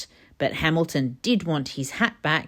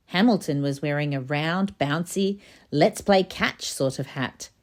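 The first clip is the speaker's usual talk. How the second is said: plain reading voice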